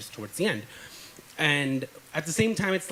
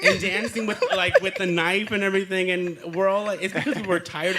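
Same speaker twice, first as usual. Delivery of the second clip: raised pitch, words broken by chuckles; amused laughing; 4 semitones higher, 5 dB louder than usual. Real, not acted. Storyteller adding the knife dance detail, laughing as he goes. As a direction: keep telling it while laughing.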